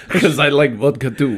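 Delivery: put-on light Russian accent; joking Russian accent. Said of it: "in a Russian accent".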